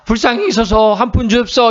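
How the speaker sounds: high-pitched beggar's plea; begging beggar. Acted. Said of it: "거지나 돼야". (높은 목소리로 구걸하듯)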